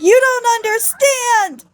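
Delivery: very high mock shriek; hysterical screaming. Beatrice refusing to be comforted, mock hysterical shriek. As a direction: squeal it in hammed-up desperation. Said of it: "she starts blubbering, crying, screaming".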